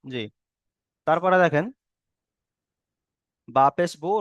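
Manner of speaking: ordinary delivery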